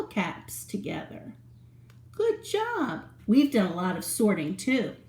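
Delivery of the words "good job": praise. Real, not acted. Praising children.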